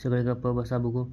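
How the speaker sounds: a light call for silence, not a full yell; narrated shout. A narrator's reading. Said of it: "शेवटी मी ओरडलो". (हलक्या आवाजात ओरडून गप्प बसवणे)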